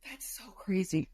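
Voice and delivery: tearful, breathless whisper; crying whisper. Real, not acted. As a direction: whisper it through tears. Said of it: "I can't stop crying".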